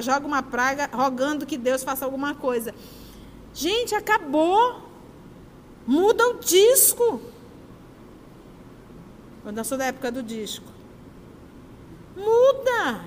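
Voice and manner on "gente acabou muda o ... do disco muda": firm exhortation. Real, not acted. Exhorting audience to move on.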